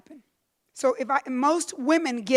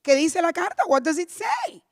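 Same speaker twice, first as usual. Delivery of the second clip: mother's question; 5 semitones higher than usual. Retold or imitated. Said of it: "my mother goes".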